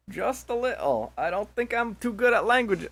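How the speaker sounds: high, put-on accented character voice; high, drawn-out character voice; wrong character voice. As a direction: pitch it well up, as if playing the wrong character. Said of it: "Oh, fucking wrong voice".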